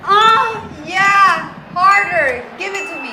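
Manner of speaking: high, loud hammed-up moaning cry; mock bedroom yelling. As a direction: ham up a passionate shout in bed. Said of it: "things people yell during sex".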